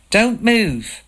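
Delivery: sharp shouted command; shouted warning. A narrator's reading. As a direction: stop them dead with a shout. Man shouts across the fen.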